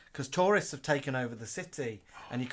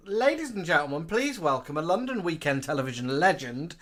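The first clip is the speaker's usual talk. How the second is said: announcer voice; 4 semitones higher, 7 dB louder than usual. Acted. Announcer introducing host.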